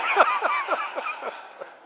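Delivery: a sudden high laugh; high amused laugh; high burst of laughter. Real, not acted. Laughs at own story.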